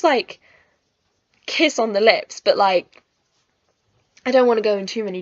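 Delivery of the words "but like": ordinary delivery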